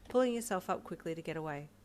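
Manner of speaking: ordinary delivery, nothing marked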